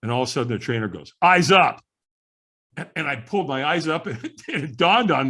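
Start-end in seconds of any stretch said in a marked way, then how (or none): 1.2-1.8 sharp command. Retold or imitated. Trainer commanding at the firewalk.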